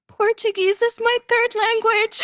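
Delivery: very high, hammed-up helpless protest; flustered plea. Driver overwhelmed by fast Portuguese, high retold plea. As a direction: plead it high, mock-desperate.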